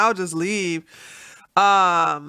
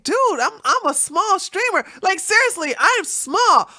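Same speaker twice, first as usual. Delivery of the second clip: higher, insistent retold plea; pleading protest; 10 semitones higher, 3 dB louder than usual. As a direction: protest that you're just small. Retold protest to warning viewers.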